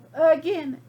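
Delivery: ordinary delivery, nothing marked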